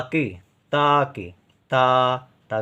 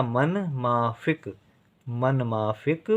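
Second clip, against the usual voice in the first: मन माफिक said slowly; slow softer recap of the word; 3 dB quieter, much slower than usual. Shown as a demonstration. Teacher to pupil, slow recap of मन माफिक. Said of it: "माँ का उच्चारण देर तक".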